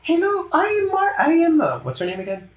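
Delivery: very high, airy, gentle alien voice; Mantis voice. Acted. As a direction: float it up high, sweet and timid. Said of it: "she's like".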